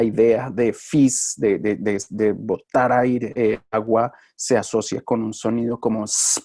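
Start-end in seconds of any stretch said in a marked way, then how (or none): 6.0-6.4 long sibilant hiss; spray-like sound. Shown as a demonstration. Teacher linking an idea to a sound, hissed sss. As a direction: imitate spray with a held sss. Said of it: "porque es como de spray".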